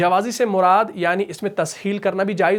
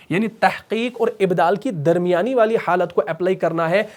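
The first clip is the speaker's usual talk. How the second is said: ordinary talk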